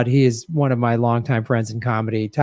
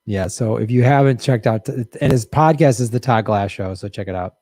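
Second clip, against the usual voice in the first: ordinary talk, nothing marked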